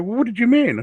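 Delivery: imitating someone puzzled by his words, a touch of mock curiosity; mock puzzled question. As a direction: imitate a confused acquaintance. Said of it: "They go".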